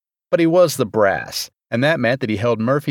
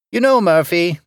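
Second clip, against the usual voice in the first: drawn-out, higher-pitched musing; slowly; 8 semitones higher, 4 dB louder than usual. A narrator's reading. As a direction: ease slowly into a personal remark. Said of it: "Parfait said slowly".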